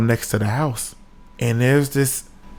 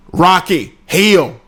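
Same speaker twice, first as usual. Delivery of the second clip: loud, higher, sharp command; calling a pet; 6 semitones higher, 5 dB louder, much slower than usual. Retold or imitated. Old man calls off his pet alligator, loud command. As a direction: call the animal to heel.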